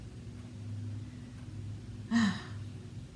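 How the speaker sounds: a slow, breathy exhale, heavy with fatigue; drawn-out tired sigh; long weary sigh. Real, not acted. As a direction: let the breath fall out, worn down. Weary pause telling of illness.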